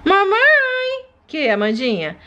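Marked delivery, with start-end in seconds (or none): 0.0-1.0 put-on high, slow humming baby; a toddler slurping her milk; baby sucking the bottle. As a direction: hum happily while drinking milk. Baby feeding sounds.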